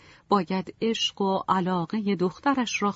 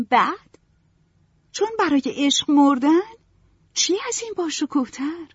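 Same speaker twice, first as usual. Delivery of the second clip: put-on high, louder, rapturous girl; passionate young Marianne; 10 semitones higher, 4 dB louder than usual. A narrator's reading. Marianne argues dying for love with mother, rapturously. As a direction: swept away by tragic romance.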